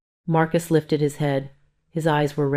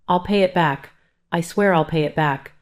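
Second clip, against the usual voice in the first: ordinary delivery, nothing marked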